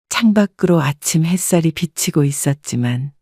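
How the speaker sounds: ordinary delivery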